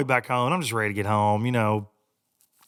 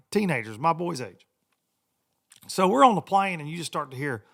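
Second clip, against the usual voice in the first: usual speaking voice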